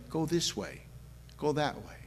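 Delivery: soft, hushed voice of the Spirit; small inner voice. Retold or imitated. Preacher voicing God's small voice to the congregation, hushed and soft. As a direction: murmur it like a gentle nudge. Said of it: "a small voice saying".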